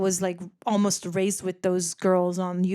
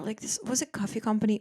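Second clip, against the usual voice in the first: ordinary talk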